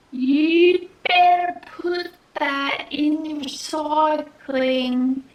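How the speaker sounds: put-on very slow, stretched-out Australian accent; attempted Australian accent. Quick-fire quote retried for viewers, exaggeratedly slow Australian accent. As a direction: drag out every word in put-on Australian. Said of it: "I'm so sorry".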